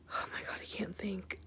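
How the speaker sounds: breathy aside under her breath; a real muttered whisper to herself. Real, not acted. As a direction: drop to a whisper, lost for words. Overwhelmed, losing her train of thought.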